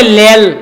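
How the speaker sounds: "লেল" slow, l for both r; drawn-out "লেল" with l sounds; রায়পুরার টানে "লেল"; Raipura dialect r as l. Shown as a demonstration. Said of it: "রেলকে বলে লেল".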